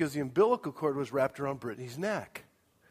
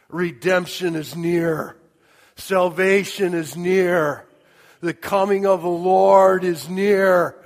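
louder, slow, rising proclamation; fervent preaching; 4 semitones higher, 12 dB louder, slower than usual. Acted. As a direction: build it like a sermon climax. Preaching at full force.

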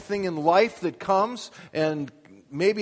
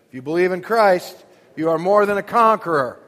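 raised, much louder preacher's declaration; loud exhortation; 9 dB louder than usual. Shown as a demonstration. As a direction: proclaim it loudly to the congregation. Repeats line, urging congregation to say.

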